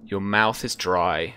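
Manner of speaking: ordinary delivery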